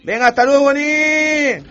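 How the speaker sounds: high, loud send-off; loud, high-pitched cheery shout; shouted farewell. Acted. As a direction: call out goodbye at the top of your voice. Contestant calls out his goodbye.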